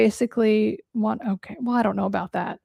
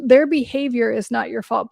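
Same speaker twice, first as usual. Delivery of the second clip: ordinary talk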